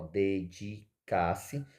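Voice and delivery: "dedicar-se" drawn out as de, di, car, se; slowly divide "dedicar-se" into its syllables. Shown as a demonstration. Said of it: "Cuja divisão silábica é dedicar-se".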